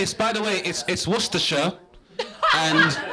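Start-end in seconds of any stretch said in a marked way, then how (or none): 1.1-1.7 Worcestershire as WUSS-tuh-shuh; British pronunciation. Shown as a demonstration. Comedian teaching the audience, Worcestershire as WUSS-tuh-shuh. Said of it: "How to pronounce this".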